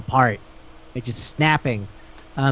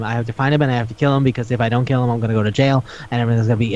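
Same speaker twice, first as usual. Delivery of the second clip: rapid, lower-pitched retold rambling; panicked rambling; 4 semitones lower, much faster than usual. Man talking himself into killing a witness, panicked rambling. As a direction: race through the imitated panicked reasoning.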